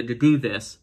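ordinary talk, nothing marked

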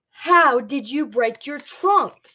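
loud, slow, frantic demand; a pig frantically shouting the question; frantic yell. A narrator's reading. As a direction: yell it out, desperate to know. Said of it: "He's questioning and screaming and yelling".

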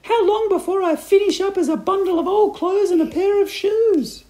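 high, loud panicked wail; hammed-up frantic wife; crying out. A narrator's reading. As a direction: keep it up high and loud, in despair. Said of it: "cried Missus Twit".

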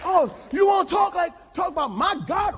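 loud, high outburst; fired-up shout. Retold or imitated. Pastor answering the trainer, loud fired-up retort. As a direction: rise to the challenge with a shout.

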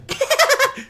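very high-pitched burst of laughter; high, odd cackle at own bit; real squealing laugh. Real, not acted. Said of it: "Why do I laugh like that?".

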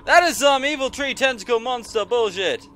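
ordinary talk, nothing marked

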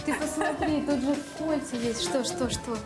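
normal talking voice

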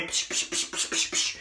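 whispery psh gunfire noises; imitated gunshots. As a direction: fire off quick unvoiced psh shots. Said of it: "makes that sound".

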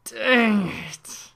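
high, loud, drawn-out curse; long falling yell; frustrated yell. Real, not acted. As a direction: howl in frustration at losing. Character dies.

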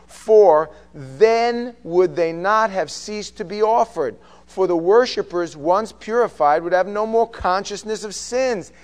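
slow, loud proclaimed verse; scripture reading. Acted. Reads scripture aloud.

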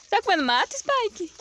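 high, sing-song talk to the dog. Real, not acted. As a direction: coo at the pet. Talking to the dog.

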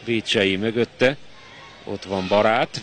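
usual speaking voice

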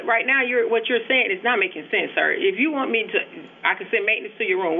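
ordinary talk, nothing marked

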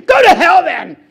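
hammed-up angry parting shout; mad and angry. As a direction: imitate an offended soul winner lashing out. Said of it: "they get mad and angry".

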